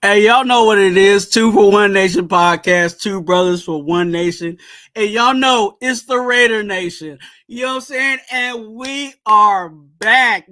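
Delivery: loud, pumped-up co-host shout-out; hype podcast intro. Real, not acted. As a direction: hype up the crowd at the top of the show.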